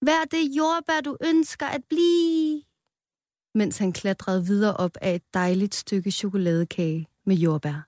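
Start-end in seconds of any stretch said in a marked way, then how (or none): 0.1-2.6 much higher, slow ringing shout; Rød shouting his motto on the plate. A narrator's reading. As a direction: proclaim it from the cake top. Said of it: "råbte".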